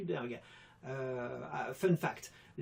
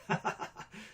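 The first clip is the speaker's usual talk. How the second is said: breathy laugh. Real, not acted. Laughs after own impression.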